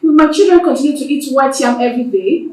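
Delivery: loud, indignant objection; angry protest. Acted. A wife disputes her husband's claim before the elders, angry protest. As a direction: cut in loudly with an outraged retort.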